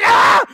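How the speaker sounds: high, loud short yelp of fright; startled scream. Real, not acted. Jump scare.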